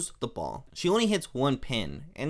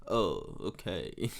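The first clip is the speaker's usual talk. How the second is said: put-on French accent; 9 semitones lower, 9 dB quieter, much slower than usual. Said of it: "he's supposed to be French".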